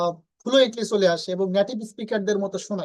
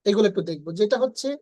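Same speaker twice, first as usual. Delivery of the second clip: ordinary delivery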